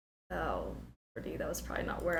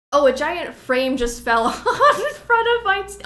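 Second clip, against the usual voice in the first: louder, higher laughing rush; 9 semitones higher, 10 dB louder than usual. Real, not acted. Streamer laughing about the frame falling on the TV. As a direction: laugh while blurting it out. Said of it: "laughs while telling the fall".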